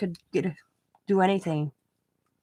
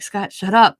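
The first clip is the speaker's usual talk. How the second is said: retold snapping; 2 semitones higher, 11 dB louder than usual. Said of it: "And I'm like".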